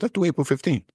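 bright eager jump up in pitch; enthusiastic. Retold or imitated. To the doctors, eagerly picking April fifteenth, excited. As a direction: pitch the date like great news. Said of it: "I'm enthusiastically going April fifteenth".

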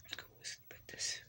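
soft whispered bedtime line. Real, not acted. Kid whispering to the sheep about sleeping. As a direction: whisper it like tucking a pet in.

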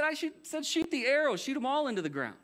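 high, exasperated mock scolding; ticked off. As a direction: repeat the order in acted indignation. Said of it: "Elisha's legitimately ticked".

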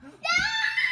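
sharp, high, loud gasp; loud excited gasp. Real, not acted. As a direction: gasp in shocked delight. Toilet paper challenge revealed.

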